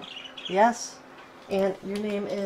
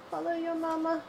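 high, softer sing-song coax; 8 semitones higher, 6 dB quieter than usual. Real, not acted. Coaxing the baby dinosaur to follow, soft high baby talk. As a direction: call a little one to follow.

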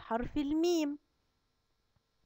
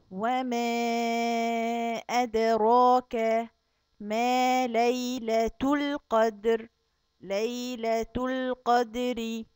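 slow tajweed chant with louder peaks; Quran recitation; 4 dB louder, much slower than usual. Acted. Teacher repeating the verses for the class, slow chant. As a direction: recite again, stretching the vowels.

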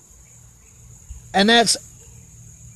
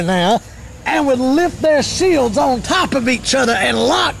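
higher-pitched, ringing declamation with falling phrase ends; fervent preaching; 5 semitones higher than usual. Real, not acted. Preaches with raised, drawn-out delivery.

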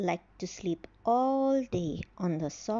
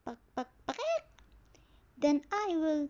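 clucking hen; 5 semitones higher than usual. Acted.

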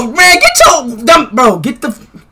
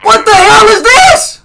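shocked yell; 7 semitones higher, 3 dB louder than usual. Acted. Shocked at a strange thing.

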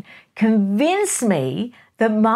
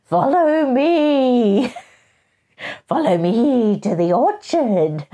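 slow, high, loud spooky tour guide voice; hammed-up ghost tour guide beckoning; 5 semitones higher, 4 dB louder, slower than usual.

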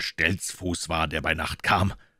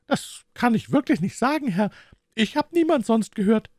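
much higher woman's character voice; boatman's wife answering; 4 dB louder than usual. A narrator's reading. Wife tells the detective whom she heard, high wifely voice.

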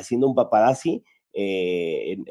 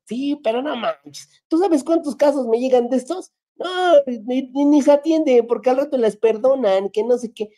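dismissive clerk; 11 semitones higher than usual. Retold or imitated. Clerk keeps dismissing the man.

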